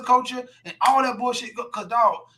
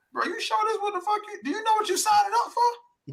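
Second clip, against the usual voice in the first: higher, doubtful friend's warning; concerned friend; 9 semitones higher, 3 dB quieter than usual. Retold or imitated. Friend questioning him before his wedding. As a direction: ask it like a worried friend pulling you aside.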